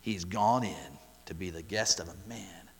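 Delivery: low, soft sneer. Retold or imitated. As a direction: sneer it with contempt. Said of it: "You can just hear the sneer in their voice".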